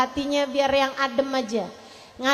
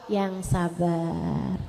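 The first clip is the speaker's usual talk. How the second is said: slow drawn patience; 8 semitones lower, 6 dB quieter, much slower than usual. Shown as a demonstration. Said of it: "Ustazah digalakin sama mertua".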